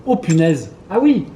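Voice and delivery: rising amazed exclamations. Real, not acted. Reaction to the goat imitation. (émerveillement croissant)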